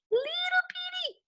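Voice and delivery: very high, small and slow; tiny squeaky voice. Shown as a demonstration. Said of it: "objects that are supposed to be far away".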